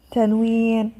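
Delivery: ordinary delivery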